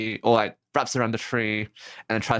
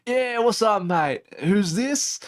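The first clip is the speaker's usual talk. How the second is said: higher-pitched, hammed-up broad Aussie; broad Aussie accent; 7 semitones higher, slower than usual. Stranger picking up the phone to callers, broad Aussie accent. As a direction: imitate a bloke with a heavy Aussie accent. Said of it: "heaviest, uh, broad Aussie accent".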